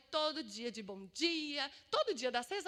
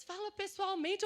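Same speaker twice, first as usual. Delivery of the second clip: raised, louder retold advice; matter-of-fact suggestion; 6 semitones higher, 5 dB louder than usual. Silas telling her to do it face to face, firm suggestion. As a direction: suggest it firmly, as someone else's advice. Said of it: "E aí o Silas".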